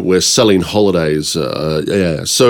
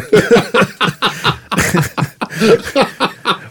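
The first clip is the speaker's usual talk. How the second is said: long high burst of laughter; real, helpless high laugh; 13 semitones higher than usual. Real, not acted. Guest cracking up at the joke, laughing.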